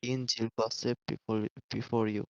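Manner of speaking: ordinary talk, nothing marked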